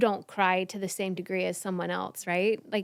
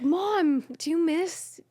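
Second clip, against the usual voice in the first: higher-pitched daughter's voice; imitated daughter; 7 semitones higher, slower than usual. Daughter asking Mom after a play, imitated in higher voice. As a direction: ask Mom in a raised, childlike pitch.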